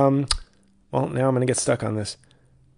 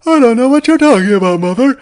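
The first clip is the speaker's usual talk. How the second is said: higher, louder mock Norman; a son whining back at mother; hammed-up Norman pleading; Norman Bates impression; 12 semitones higher, 12 dB louder than usual. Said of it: "Norman Bates".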